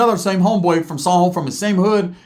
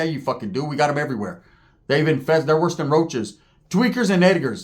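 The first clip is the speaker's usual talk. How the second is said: ordinary talk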